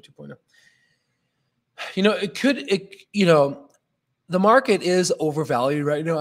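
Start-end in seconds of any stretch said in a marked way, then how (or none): 4.3-6.2 talking through a yawn; words stretched by a yawn. Real, not acted. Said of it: "I don't know why I'm yawning right now".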